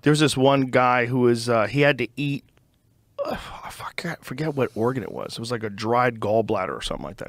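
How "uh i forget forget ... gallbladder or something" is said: whispered aside. Real, not acted. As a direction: whisper it to yourself.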